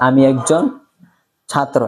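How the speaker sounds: normal talking voice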